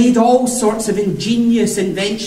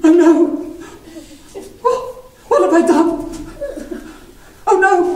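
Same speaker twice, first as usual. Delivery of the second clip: alarmed cry; 8 semitones higher than usual. Acted. Daedalus realising what he has done, crying out. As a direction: cry out in sudden horror.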